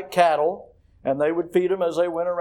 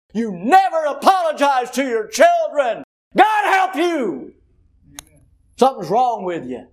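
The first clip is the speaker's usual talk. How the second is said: mock shout; 8 semitones higher, 6 dB louder than usual. Preacher imitating people who told him off, shouting. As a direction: bellow it like a scandalised critic. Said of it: "I've been told by people".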